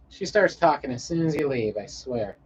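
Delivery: usual speaking voice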